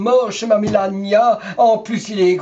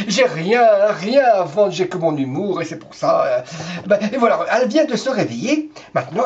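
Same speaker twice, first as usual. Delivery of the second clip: normal talking voice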